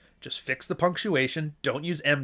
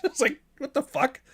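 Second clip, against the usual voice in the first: laughing disbelief; 12 semitones higher than usual. Real, not acted.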